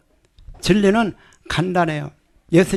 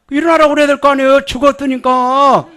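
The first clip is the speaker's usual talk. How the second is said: fast, loud, high rhetorical push; preacher excited and loud; fired-up preaching; 6 semitones higher, 5 dB louder than usual. Real, not acted. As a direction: press the point hard. Emphatic preaching point.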